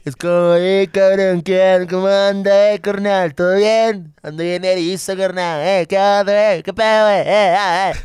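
hammed-up stoned dude calling out; loud stoner voice. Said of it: "Esa es mi voz pacheca".

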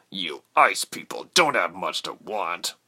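slow, lightly voiced soldier; soldier's voice. A narrator's reading. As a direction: put on a mild soldier's disdain. Soldier sneers at captive.

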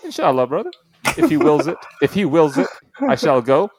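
high, playfully solemn declaration; mock pious. Podcaster joking about going to nationals, mock pious.

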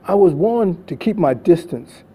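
usual speaking voice

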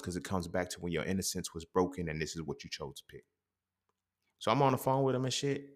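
normal talking voice

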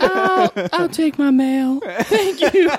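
very high, drawn-out falsetto. Retold or imitated. Singer collecting his mail, high falsetto impression. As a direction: croon an everyday request in falsetto. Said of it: "he has to talk in that voice".

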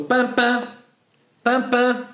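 high honking double beep; imitated car horn. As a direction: keep beeping like a horn. (imitando buzina insistente)

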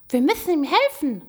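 high, loud little animal voice; urgent hedgehog. A narrator's reading. Hedgehog urges the others to help, high and urgent. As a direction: pipe up urgently.

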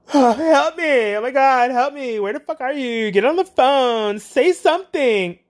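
high, loud, pleading; hammed-up desperate caller begging for help; high loud mock panic demanding the agent talk; mock frantic plea.

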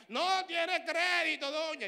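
loud, slow mock cashier call. Cashier calling out the declined card, loudly. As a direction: announce it to the whole store.

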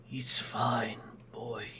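frail sick man calling faintly; faint, weak voice from the sickbed; weak old voice. Acted. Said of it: "slightly weak voice calling out".

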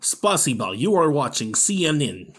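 loud announcer with mock Russian accent; Russian newsreader. Impression of CNN in five years, Russian-accented anchor. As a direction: open a broadcast in a Russian accent. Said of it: "my impression of C N N in five years".

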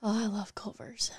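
hushed aside; a quick whispered aside. Real, not acted. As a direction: whisper it under your breath. Aside while partner talks.

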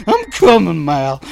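loud, high call of reassurance; urgent scream. Acted. Father racing to his daughter calls out, urgent and loud. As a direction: cry out that help is on the way. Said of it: "I wanted to scream that out".

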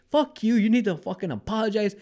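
high-pitched indignant rant, not shouted; retold angry arguing. Teller replays standing up to the tall driver, mock indignant.

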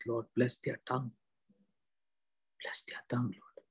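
hushed, earnest prayer to God. Acted.

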